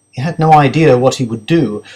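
ordinary delivery, nothing marked

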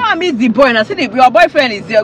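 loud, scolding shout; angry shout. Acted. Driver yelling at the cheating wife, angry shout. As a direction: yell it at her.

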